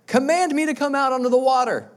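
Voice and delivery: high, loud, bold plea; eager Peter. Acted. Peter shouting to Jesus from the boat, high and bold. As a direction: call out boldly like a brash fisherman. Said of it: "here comes our boy Peter".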